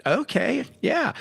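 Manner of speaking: put-on high, bubbly young girl voice; squeaky cheerful girl, way up high; chipper girl voice. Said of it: "twelve-year-old girl with vocal fry, she went".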